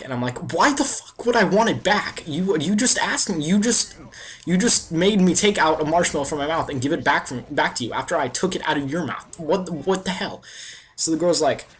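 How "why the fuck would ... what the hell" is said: much higher, louder reenacted rant; exasperated outburst. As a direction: rant at her in disbelief, pitch up. Outrage after she asks it back.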